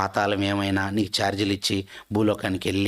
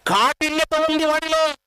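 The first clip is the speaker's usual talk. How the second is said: fiery preaching; 13 semitones higher than usual. Acted. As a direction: preach it with rising fervour. Preaching at full force.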